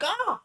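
sudden high owl screech. Acted. The owl shrieks at the explosion. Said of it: "That's what he does. He's an owl".

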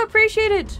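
excited thanks shouted to a follower; bright thanks. Real, not acted. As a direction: call out your thanks to a new follower.

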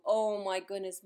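ordinary delivery

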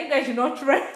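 high, giggling boast; laughing. Real, not acted. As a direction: laugh at your own words. Laughing at own bravado.

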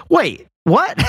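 mock-incredulous high-pitched reaction; acted-out disbelief; baffled double take. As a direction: jump up in pitch, stunned and confused. Retells own baffled reaction.

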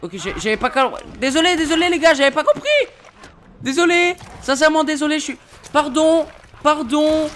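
high, loud hammed-up apology; mock apology. Gamer hamming up an apology to viewers, high and loud. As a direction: overplay the sorry like a guilty kid.

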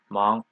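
Mang rising from a low start; rising-tone demo of Mang; second tone. Shown as a demonstration. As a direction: lift the pitch through Mang. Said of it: "second tone".

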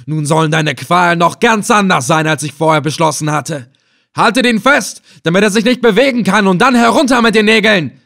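enraged threat building to a high, loud command; vengeful villain losing his temper; grinding fury. A narrator's reading. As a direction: start seething, build to shouted orders. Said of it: "ballte die Fäuste gegen mich und knirschte".

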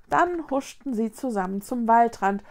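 usual speaking voice

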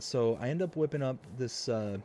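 usual speaking voice

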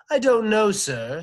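high, loud butler voice; story butler replying. A narrator's reading. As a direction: answer the master in a high, loud servant's voice. Butler answers his master.

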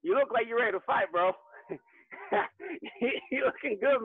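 usual speaking voice

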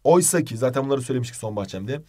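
ordinary talk

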